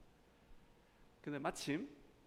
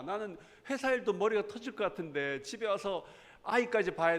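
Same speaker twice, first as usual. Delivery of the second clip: raised-pitch, exasperated outburst, retold; angry husband; 5 semitones higher, 4 dB louder than usual. Overworked husband venting at his wife over childcare, acted angry. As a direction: act out an overworked husband losing his temper. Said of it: "막 화를 내면서".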